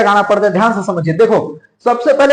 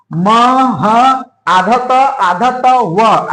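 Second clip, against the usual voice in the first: slow, letter by letter; spelling it out slowly; much slower than usual. Shown as a demonstration. Teacher slowly spells out the word for students. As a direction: sound out each letter of महत्व deliberately. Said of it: "spelling out महत्व letter by letter".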